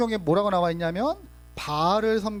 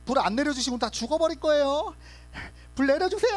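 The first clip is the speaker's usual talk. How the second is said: desperate pleading; 8 semitones higher than usual. Retold or imitated. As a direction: plead with the god, climbing in pitch. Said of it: "하고 막 몸에 칼을".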